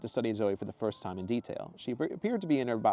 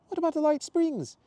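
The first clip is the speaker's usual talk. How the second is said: much higher, louder cheerful woman's voice; Zoe's exclamation; 6 dB louder than usual. Acted. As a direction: greet him brightly and high. Zoe voiced.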